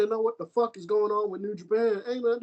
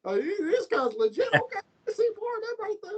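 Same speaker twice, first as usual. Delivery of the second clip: high, fast, cracking with excitement; a fan blown away by a new wrestler, excited high; 10 semitones higher than usual. Retold or imitated. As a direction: sell the amazement, voice breaking high. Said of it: "I was like".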